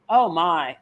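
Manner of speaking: ordinary talk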